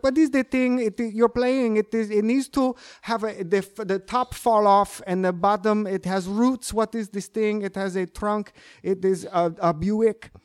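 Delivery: put-on foreign-accented classical guitarist. Doing the college teacher behind his classical guitarist, foreign accent. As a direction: imitate a teacher with accented third-language English. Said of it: "more like this".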